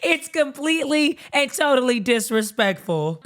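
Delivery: slow, giggle-broken mock complaint; retold telling-off dissolving into laughter; laughing while protesting. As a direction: struggle through the words between giggles. Laughs through her own retold protest.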